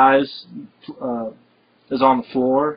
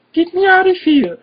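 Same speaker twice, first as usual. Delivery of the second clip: much higher, loud, hammed-up fake panic; mock panicked plea; 5 dB louder than usual. Hero fakes panic to trick a guard, high hammed-up yell. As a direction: play a hysterical victim, overdone. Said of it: "He goes down to the guy like".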